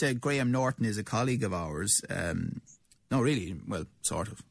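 normal talking voice

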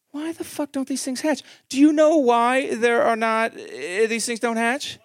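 high, loud desperate question; frantic searching. Retold or imitated. Dad frantically asking his phone why eggs don't hatch. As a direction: demand an answer from the phone. Googling in frustration.

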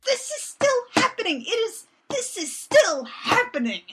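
high, exasperated protest; high-pitched incredulous rant; mock outrage. Real, not acted. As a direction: hammer it out in disbelief.

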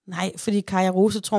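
usual speaking voice

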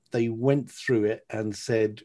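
ordinary delivery

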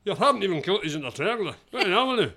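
put-on fast, light Scottish accent; half-hearted Scottish husband complaint. Accent on request from a co-host, fast light Scottish accent.

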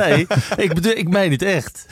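high, quick, insisting through laughter; laughing protest. Real, not acted. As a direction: laugh while defending that you mean it. Laughing protest after being teased.

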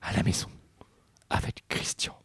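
soft dreamy whisper. A narrator's reading. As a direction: breathe it in wonder. Dreamy inner thought.

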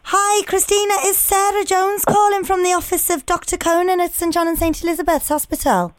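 high, put-on Scouse receptionist voice; Liverpool-accented secretary. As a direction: introduce yourself as a Scouse hospital secretary. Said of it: "I'm gonna pretend I'm the secretary".

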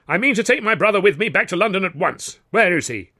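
high-pitched, pompous demand; indignant. Acted. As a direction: demand your brother back, outraged. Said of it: "was puce with indignation".

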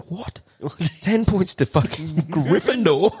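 high, incredulous laugh through the words; laughing disbelief. Retold or imitated. Retelling the Gryffindor dare to his mate, laughing in disbelief. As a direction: repeat the dare in stunned giggles.

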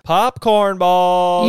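relishing the name, long last vowel; drawn-out exclamation. Pretend. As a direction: draw it right out, loud and high. Echoes recipe name with relish.

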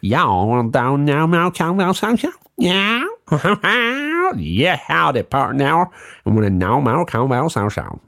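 high-pitched mock American accent. Shown as a demonstration. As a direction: whoop it up in a silly American twang. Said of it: "That's a good American accent".

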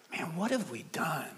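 very soft stunned question; softly appalled madman; hushed dismay. Acted. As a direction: ask it under your breath. Madman lamenting in the parable.

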